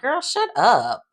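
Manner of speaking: high, slow, drawn-out put-down; sassy dismissal. Retold or imitated. Viewer talking back to smug Haley, sassy and slow. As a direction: brush her off with mock sass.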